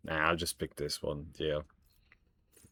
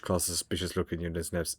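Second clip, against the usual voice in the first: ordinary delivery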